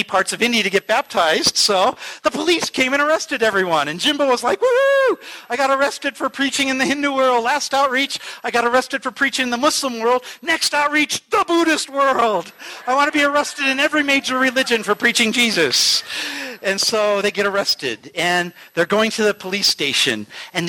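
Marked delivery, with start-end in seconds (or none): 4.6-5.2 a very high, loud woohoo; gleeful whoop. Retold or imitated. Jimbo celebrating his arrest with a high, loud whoop. 5.5-16.0 bright, proud tally of arrests; eager boasting. Retold or imitated. Jimbo boasting to Fred about his arrests, eager and proud.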